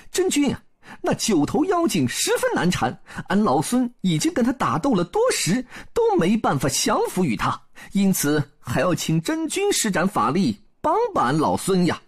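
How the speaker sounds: high-pitched Monkey King making an appeal; 孙悟空 pleading for help. A narrator's reading. (孙悟空高嗓门恳请相助)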